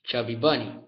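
talking with a full mouth; cheeks stuffed full. Real, not acted. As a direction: say it through cheeks full of marshmallows. Mouth crammed with marshmallows.

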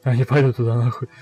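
usual speaking voice

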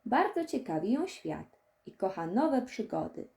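ordinary delivery

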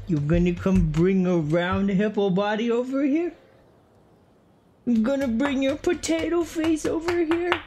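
high sing-song pet talk. Real, not acted. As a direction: coo at a pet hippo. Cooing at the pet hippo.

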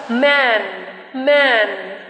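"man" with a wide flat vowel between a and e. Shown as a demonstration. Teacher showing students the flat ae in man. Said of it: "Âm e bẹt tức là giống như chữ A và chữ E".

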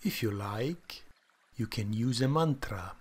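calm, soft guided meditation voice; soft spa whisper. Acted. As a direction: guide them gently into stillness.

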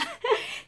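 high burst of laughter; bright laughing burst. Real, not acted. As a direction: break into a giggle. Laughs at her own question.

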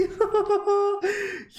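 bright burst of giggles; little giggle at the new dragon; high giggle. Real, not acted.

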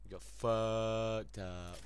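slow, savoured gloating; gloating after the kill. Real, not acted. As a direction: stretch every word out. Gloating, drawn out.

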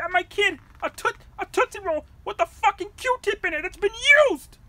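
loud, high rant building to a roar; enraged at whoever tampered the candy; furious rant. Acted. Raging at unknown candy tamperer.

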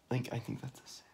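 hushed, unvoiced whisper. Real, not acted. Friend whispering to friend about sin, whispered. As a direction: whisper it like a guilty secret.

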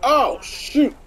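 shocked outcry. Real, not acted. Shocked at people being beaten.

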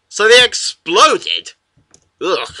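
usual speaking voice

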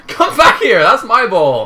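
high, loud yell with a laugh; shouting after the ball. Real, not acted.

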